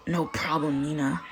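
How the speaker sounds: softened. A narrator's reading. Katsuki answering Mina gently, softened. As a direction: let the gruffness go for once. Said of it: "Katsuki's eyes softened".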